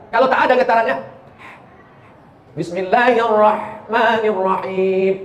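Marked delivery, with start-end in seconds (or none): 2.6-5.1 Bismillahirrahmanirrahim drawn out slowly in chanted tajwid; slow Quran recitation. Shown as a demonstration. Preacher demonstrating recitation to the congregation, slow and chanted. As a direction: recite it slowly, every letter clear. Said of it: "Ayat pertama".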